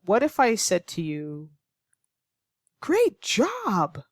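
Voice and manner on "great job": high, bright praise leaping way up; excited, encouraging. Shown as a demonstration. Teacher praising a student, high and excited. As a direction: cheer them on with real delight. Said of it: "my tone is very excited, very encouraging".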